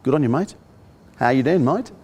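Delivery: light Aussie "Good on you, mate", with "mate" said like "mait"; imitating a cheery Australian bloke; Australian accent. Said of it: "In Australia, they call you mate".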